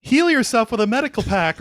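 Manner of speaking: high, loud mock exasperation; retold yelling. Viewer yelling at the gamer he watches, mock exasperation. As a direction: yell advice at a screen, half in jest. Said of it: "I'm, like, yelling at him".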